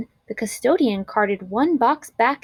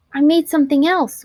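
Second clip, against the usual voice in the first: higher, louder and fast; boy eager to show teacher, quick and raised; shy excited boy; 6 semitones higher, 6 dB louder, faster than usual. A narrator's reading. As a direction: blurt out the surprise. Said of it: "William said".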